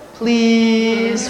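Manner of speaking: put-on high, loud little-kid beg, endlessly stretched; drawn-out pleading child. Niece begging mom for a snack, long high whiny plea. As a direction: imitate a child dragging out PLEASE forever. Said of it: "we pull out the S's all the way to infinity".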